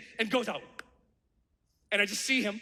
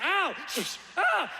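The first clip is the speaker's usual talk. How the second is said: pained yelp; 4 semitones higher than usual. Retold or imitated. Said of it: "I was like".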